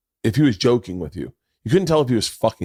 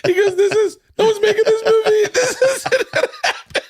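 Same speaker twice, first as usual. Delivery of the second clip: high squealing laughter through the words; can barely get it out for laughing; wheezing with real laughter; laughing hard. Real, not acted.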